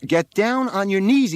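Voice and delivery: high, loud command; shouted order. A narrator's reading. Boy on the roof overriding his mother, shouted order. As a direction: cut in with a high shouted demand.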